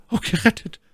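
high, faint-hearted lady's echo; Milady stammering. A narrator's reading. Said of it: "stammelte sie". (hohe, benommene Frauenstimme)

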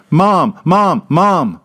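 put-on toddler shouting. A narrator's reading. Toddler shouting again for his mother. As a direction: yell for mom like a toddler. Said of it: "Tommy shouts again".

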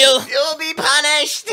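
high, slow, laughing through the mock; high-pitched preacher boy. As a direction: shriek it slowly while cracking up. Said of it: "It's like that high-pitched fucking".

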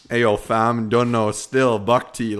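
loud, mocking Toronto wannabe slang; Toronto slang accent.

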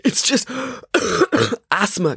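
high, gasped words broken by coughs; gasping. Acted. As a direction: gasp it out through a coughing fit. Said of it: "gasped Maya".

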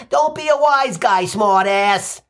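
lightly voiced mother snapping back; a mild mock snap from mom; a hint of a scolding mother. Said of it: "she snaps".

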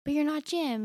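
a hammed-up stubborn Dwight; insistent Dwight. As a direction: push back hard, up high. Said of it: "he's like".

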